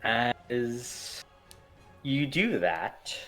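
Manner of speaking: ordinary talk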